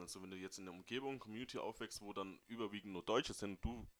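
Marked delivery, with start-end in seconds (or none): none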